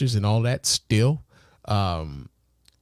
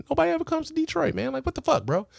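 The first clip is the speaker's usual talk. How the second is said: real frustration, voice shooting higher; exasperated outburst; faster than usual. Real, not acted. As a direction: pitch jumps way up in disbelief. Complaining family never visits Detroit.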